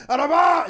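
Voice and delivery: loud, high panicked outburst; retold scream. Man telling how he ran to the toilet, retold scream. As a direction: burst out in a high retold yell. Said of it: "And I just screamed".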